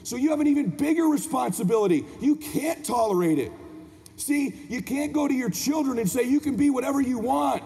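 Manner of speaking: high, driving motivational sermon; motivational preaching. Acted. Speaker challenging parents to be their children's hero, impassioned preaching. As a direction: drive each phrase home like a preacher. Motivational speech at full force.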